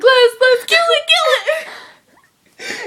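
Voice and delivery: high, loud reenacted panic; scared shriek. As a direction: shriek it in laughing mock terror. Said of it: "we were scared".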